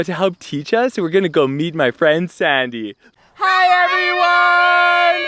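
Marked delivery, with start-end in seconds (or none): 3.3-5.3 excited greeting. Real, not acted. Greeting the camera, calling out.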